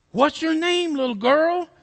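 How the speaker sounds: loud, high, emphatic question; big fellow, acted loud; big man's voice. Big man questions girl.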